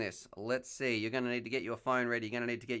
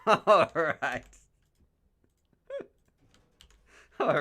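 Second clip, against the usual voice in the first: laughed-out, higher and louder; laughing; 6 semitones higher, 10 dB louder, much slower than usual. Real, not acted. Host reacting to a viewer's cheeky joke, laughing. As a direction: say it through a burst of laughter.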